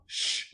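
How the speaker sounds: sharp hushing "shh"; urgent shush. Acted. Boy hiding behind the hedge, urgent shush. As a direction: hush your companion. Hushes friend while hiding.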